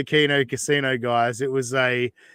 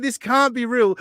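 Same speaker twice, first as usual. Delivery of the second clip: disbelief; 9 semitones higher, 3 dB louder than usual. Retold or imitated. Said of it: "I was like".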